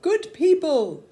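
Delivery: slow, loud, high call to the village; a soldier summons the peasants with a slow, loud call. A narrator's reading. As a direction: hail the crowd loudly and slowly. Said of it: "called out".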